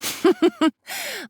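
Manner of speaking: a shrill, high gloating cackle; triumphant high-pitched laugh; high villain laugh. Acted. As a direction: laugh at their foolishness. Villain gloats over treasure.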